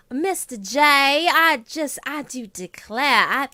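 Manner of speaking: put-on high plantation-South accent; Harley Quinn. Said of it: "hers is plantation South".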